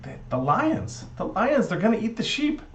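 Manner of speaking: high-pitched urgent warning; alarmed son. Acted. Son warns Noah lions will eat the sheep, alarmed.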